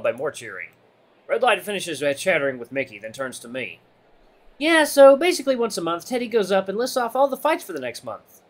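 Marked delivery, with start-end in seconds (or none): 4.6-8.4 high, lively buddy voice; upbeat explainer. Acted. Redline explaining monthly fight announcements to newcomer, upbeat and high. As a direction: fill in the newcomer cheerfully.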